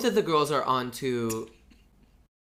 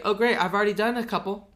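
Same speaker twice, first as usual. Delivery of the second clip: high, louder and fast; mock eager; 8 semitones higher, 5 dB louder, faster than usual. Eager reply about the sutras, high and fast. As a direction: brightly rush out the news. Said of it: "she's like".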